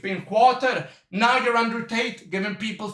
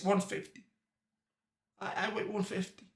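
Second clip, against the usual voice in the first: mocking his friend with a much softer, hesitant, sheepish mumble; ashamed mumble; 2 semitones lower, 13 dB quieter than usual. Mocking his friend's weight, a much softer ashamed mumble. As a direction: shrink the number down like you're embarrassed to say it. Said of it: "You said, you said it like this".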